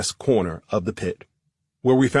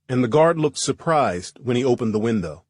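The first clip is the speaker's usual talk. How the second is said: usual speaking voice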